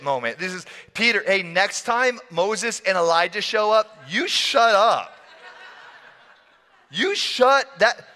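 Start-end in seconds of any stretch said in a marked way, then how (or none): 0.9-5.1 high, exasperated telling-off; playful exasperated reprimand; mock scolding. 6.9-8.1 high, insistent telling-off; playful, emphatic reprimand; mock scolding.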